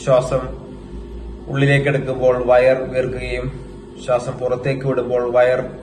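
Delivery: guided meditation. Acted. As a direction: guide them into stillness, phrase by phrase, in an even tone. (ധ്യാനത്തിലേക്ക് നയിക്കുന്ന സാവധാന ശബ്ദം)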